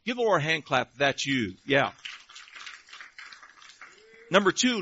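ordinary talk, nothing marked

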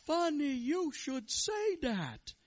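high, loud, drawn-out rabbi. Acted. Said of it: "The Rebbe looks around and says".

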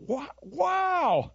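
high, drawn-out mock amazement; amazed wow. Preacher imitating people doing a double take, amazed. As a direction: let the wow soar up in astonishment. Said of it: "It makes people just go".

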